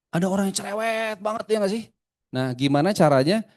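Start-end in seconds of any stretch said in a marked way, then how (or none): 0.1-1.8 high, stretched chatterbox voice; chatty person. Acted. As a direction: pitch it up like a talkative person. Mimics chatty people, contrasted with cold ones. (gaya orang cerewet, nada tinggi)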